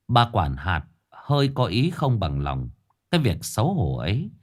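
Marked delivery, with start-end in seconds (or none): none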